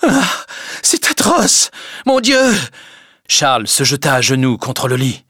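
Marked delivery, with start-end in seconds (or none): 0.0-2.5 high, slow, anguished cry of pain; cry of agony. Acted. Emma in convulsions crying out to God, high and anguished. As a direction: wail it out in agony. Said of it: "Elle s'écria".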